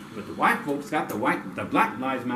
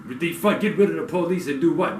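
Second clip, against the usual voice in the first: ordinary talk, nothing marked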